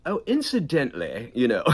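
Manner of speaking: posh, smarmy British ad voice; oily British salesman tone; smarmy British commercial voice. Shown as a demonstration. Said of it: "it was talking like this".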